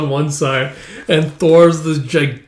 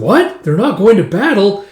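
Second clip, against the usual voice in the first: higher-pitched astonished question; surprised; 5 semitones higher, 3 dB louder than usual. Acted. Girl hearing the whispered secret, surprised. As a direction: react to news you didn't expect.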